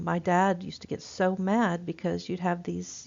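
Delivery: normal talking voice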